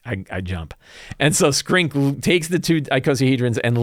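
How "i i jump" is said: much softer, lower calm resolve; a hushed, resigned decision; quiet resolve. Retold or imitated. Player declaring her character's sacrifice, soft and resigned. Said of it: "And she's like".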